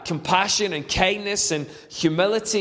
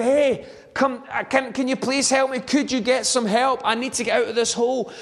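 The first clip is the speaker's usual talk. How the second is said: higher, urgent call up from the hole; desperate plea; 6 semitones higher than usual. Retold or imitated. Man stuck in a hole begs his passing friend, higher pleading. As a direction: beg your friend to get you out.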